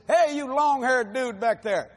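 mock preacher singling out the long-haired kid; calling out. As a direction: call him out from the pulpit.

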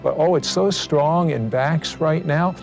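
rising, fervent healing proclamation; urgent prayer over the sick. Acted. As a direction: preach healing with mounting force.

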